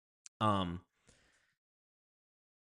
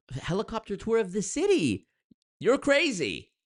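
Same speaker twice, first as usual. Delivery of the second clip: much higher, scoffing at the idea; mocking disbelief; 10 semitones higher than usual. Real, not acted. Vlogger scoffing at a normal girl's helicopter date, higher mocking disbelief. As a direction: laugh it off as crazy.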